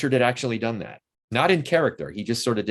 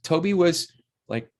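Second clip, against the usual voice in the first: ordinary talk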